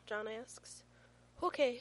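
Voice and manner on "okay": put-on high, loud child's agreement; eager little boy. A narrator's reading. Small son agrees to shop with dad, eager. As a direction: chirp it loudly like an eager kid.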